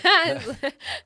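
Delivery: loud, high burst of laughter; real laugh. Real, not acted. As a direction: laugh out loud at your own failed attempt. Laughs at failed posh accent.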